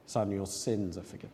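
low, very soft pronouncement; hushed, tender absolution; gently forgiving. Retold or imitated. Jesus quietly forgiving the paralysed man, gently.

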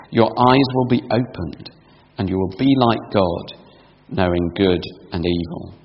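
quiet solemn reading aloud; scripture reading. Acted. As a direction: read the passage quietly and evenly. Reads Genesis passage aloud.

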